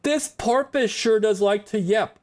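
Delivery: mock viewer voice. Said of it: "you might be like".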